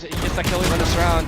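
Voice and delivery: building to a shout; rising excited hype. Real, not acted. Clutch moment rising hype.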